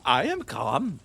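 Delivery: ordinary delivery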